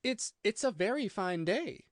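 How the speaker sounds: timid. A narrator's reading. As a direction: stammer lightly, politely nervous. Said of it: "said a timid voice".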